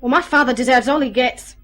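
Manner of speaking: loud, forceful condemnation; angry, hard-hit outburst; bitter anger. Acted. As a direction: lash out at an absent father. Bitter daughter condemning her jailed father.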